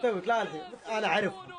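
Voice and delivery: normal talking voice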